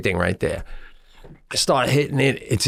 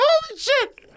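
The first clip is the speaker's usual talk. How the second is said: quick, much higher squeal rising at the end; excited squeal. Retold or imitated. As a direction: shriek it in happy disbelief. Excited at the working scooter.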